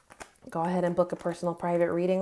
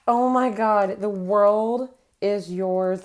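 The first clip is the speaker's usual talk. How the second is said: excited; 3 semitones higher, 5 dB louder, slower than usual. Real, not acted. Still thrilled by the card.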